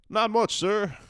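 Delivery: Jo squeaks out a high answer; high, nervous little voice; high girlish reply. Acted. Girl answering a stern old man, high and nervous.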